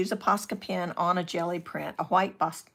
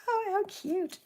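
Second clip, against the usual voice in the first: cooing. Real, not acted. Cooing over a froggy stamp.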